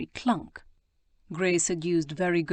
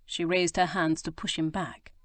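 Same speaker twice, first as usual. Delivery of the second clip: ordinary delivery, nothing marked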